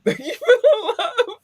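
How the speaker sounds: squealed, high and loud thank you; overjoyed squeal of thanks; high gushing thanks. Real, not acted. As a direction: gush it up high with delight. Emotional thanks to listeners.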